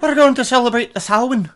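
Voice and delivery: a hint of Irish brogue, teasing; mock Irish lilt. Jokey Irish voice.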